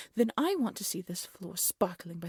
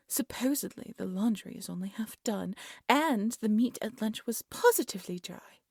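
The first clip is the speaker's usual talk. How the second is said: usual speaking voice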